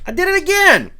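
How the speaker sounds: squeaky yelp after hitting the mic; mic bump. Real, not acted. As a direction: bumps the microphone again, startled. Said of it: "Just keep my hands down here".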